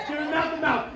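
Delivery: ordinary talk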